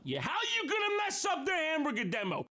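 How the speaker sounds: mock outrage shout. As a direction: yell at the robot in mock despair.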